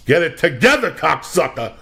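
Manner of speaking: loud, high burst at an imagined target; hammed-up scolding shout; ranting yell. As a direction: yell it like a mock wake-up call. Ranting at imagined fake artists.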